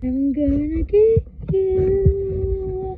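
slow, loud menacing drawl; menacing monster voice. Acted. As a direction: stretch out each word like a stalking monster. Voicing the animatronic, slow.

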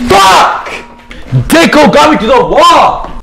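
loud, high frustrated shout; angry yell. Real, not acted. Gamer raging at the player who pinned him, shouting. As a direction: yell after getting pinned. Killed in the game.

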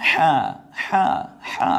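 হা squeezed from the throat, loud; forceful, constricted-throat হা, separately repeated; throat-pressed হা. Shown as a demonstration. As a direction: press the throat hard on each হা. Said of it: "গলায় চাপ দিয়ে".

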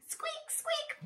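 tiny high squeaky mouse; mouse squeak. Acted.

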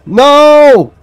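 alarmed shout. Real, not acted. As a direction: yell it as things go wrong. Alarmed at game event.

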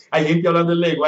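ordinary talk, nothing marked

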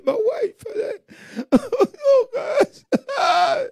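high-pitched pretend sobbing; hammed-up crying of a bereaved husband; mock wailing. Mimics a husband mourning wife.

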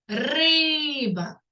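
"риба" with a long и; drawn-out second sound. Shown as a demonstration. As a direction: stretch the и in "риба". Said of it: "Наступний".

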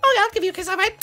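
high, dainty princess voice. Pretend. A new princess greeting the mole in a high voice. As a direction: play the princess sweet and high.

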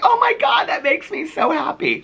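very high, drawn-out mock squeal; hammed-up retold joy; delighted squeal. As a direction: gush in a put-on squeaky voice, slowing at the end. Retold delighted reaction to compliment.

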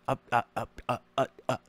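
stammering imitation; mocking stutter. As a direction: stutter like a flustered guy. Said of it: "you just stuttered".

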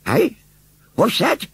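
put-on high-pitched, startled old woman's query; just jolted awake and puzzled; startled old lady. A narrator's reading. Old lady sits up with a jerk, startled.

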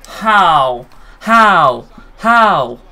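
loud, rising cries of disbelief; disbelieving shout. Real, not acted. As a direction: call out in outrage. Opponent scores, disbelief.

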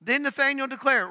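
ordinary talk

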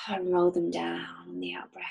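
whispered breathing cue; whispered instruction. Acted. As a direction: let it go out on a whisper. Guiding a breathing exercise.

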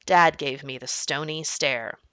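plain reading voice

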